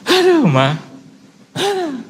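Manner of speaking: groaning complaint. Retold or imitated. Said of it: "saya suka".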